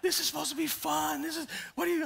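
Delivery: high, strained mock distress; exaggerated frantic complaint; panicked protest. Panicked tourist protesting in the raft.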